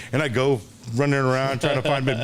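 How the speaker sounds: ordinary delivery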